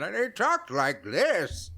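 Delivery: put-on slow old man voice. Shown as a demonstration. Showing his second character voice, slow old man. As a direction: drag it out like a creaky old man.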